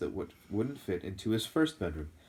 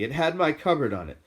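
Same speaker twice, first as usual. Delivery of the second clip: louder, hot protest; angry; 7 semitones higher, 8 dB louder than usual. A narrator's reading. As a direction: answer back angrily. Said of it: "said Harry angrily".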